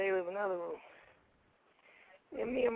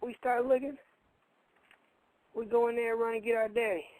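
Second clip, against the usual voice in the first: ordinary delivery, nothing marked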